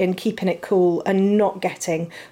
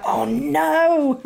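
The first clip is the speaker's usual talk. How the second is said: a laughing, higher oh no; children's mock dismay; 7 semitones higher than usual. Quoting her children reacting to the chocolate news, laughing mock dismay. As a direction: mimic the kids' giggly groan. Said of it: "And they're like".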